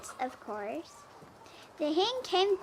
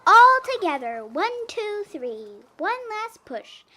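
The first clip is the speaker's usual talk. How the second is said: higher, louder heave-ho count; rallying count; 7 semitones higher, 7 dB louder than usual. A narrator's reading. The animals push the trucks free, excited count. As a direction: count them in for the big push.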